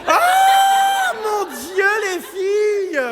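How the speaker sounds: drawn-out high shout of shock; shocked exclamation. Real, not acted. Host shocked that both girls want the same guy, long high shout. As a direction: stretch the oh way up, scandalized.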